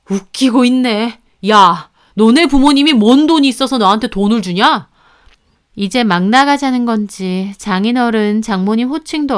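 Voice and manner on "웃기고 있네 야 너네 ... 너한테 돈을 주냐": scoffing husband. A narrator's reading. Husband mocks wife's parents.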